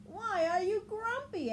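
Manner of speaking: high, loud questioning voice; others asking. Acted. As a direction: ask it up high together. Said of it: "asked the others".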